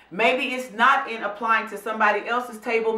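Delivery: ordinary talk, nothing marked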